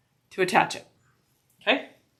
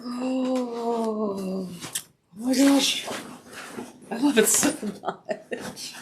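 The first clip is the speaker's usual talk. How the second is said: delighted laugh; 4 semitones higher, much slower than usual. Real, not acted. Delighted, laughing at her work.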